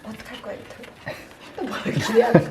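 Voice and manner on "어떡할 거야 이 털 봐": hushed whisper; whispered, startled. Real, not acted. Startled by the dog's fur.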